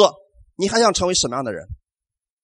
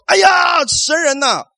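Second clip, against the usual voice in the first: high, loud cry of alarm; a retold shout of alarm, pitched high and loud; alarmed outcry; 9 semitones higher, 4 dB louder, slower than usual. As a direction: cry out to the man of God in panic. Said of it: "他们开始喊叫说".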